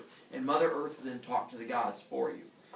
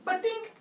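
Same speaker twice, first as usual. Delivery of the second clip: high, loud "badink" sound effect; cartoon bonk; 5 dB louder than usual. Teller imitating Hera's hit on the head, high bright ping.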